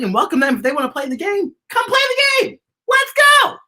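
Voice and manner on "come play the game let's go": high, loud rallying call; excited cheer. Acted. Host inviting kids to join, excited. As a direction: cheer everyone into the game.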